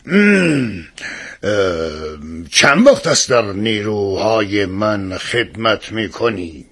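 low, put-on royal voice; hesitant king. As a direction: hem and haw, then question as a king. King quizzing the young guard.

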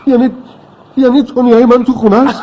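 high-pitched, amazed question; overjoyed disbelief. Acted. Father learning his girl is home, amazed and joyful. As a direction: hardly dare believe the good news.

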